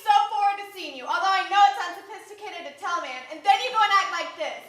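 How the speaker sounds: loud, indignant reproach; hurt accusation. Acted. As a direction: let the hurt pour out at full volume. Acted quarrel, she reproaches him.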